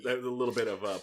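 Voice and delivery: normal talking voice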